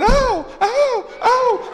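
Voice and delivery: very high mock cries; hollering wife. Preacher imitating the wife's high wailing cries. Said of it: "She's hollering".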